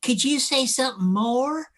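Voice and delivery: high, drawn-out Southern belle imitation. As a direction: put on a syrupy American Southern drawl. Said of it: "this little Southern belle saying".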